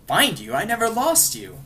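high menacing Joker taunt; mock the caller, pitch climbing; loud high villain sneer. Joker menacing Charlie on phone.

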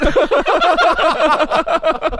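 high, loud burst of laughter; hearty laughing. Real, not acted. Laughing hard at bad joke.

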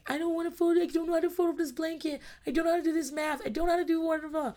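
put-on high whiny child voice; whining kid. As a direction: whine it like a kid refusing chores. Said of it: "are whining because they're like".

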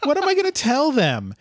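high, swooping mock-distressed dean; hammed-up dithering administrator; the dean, flustered. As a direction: swoop up high in comic dismay. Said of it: "and he is like".

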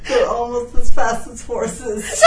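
high, laughing outburst; laughing at the turtle fact; laughing shout. Real, not acted. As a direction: shout it through giggles.

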